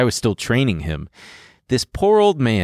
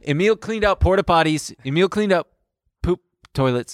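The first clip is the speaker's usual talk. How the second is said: high, sharp playful yell; 8 semitones higher than usual. Real, not acted. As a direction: shout it out teasingly. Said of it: "Why are you yelling that?".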